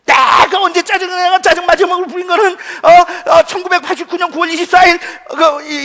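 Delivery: loud, high, defensive tirade; indignant denial. Acted. Husband denying to wife he is ever irritable, indignant shouting. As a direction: protest hotly while recalling exact evidence.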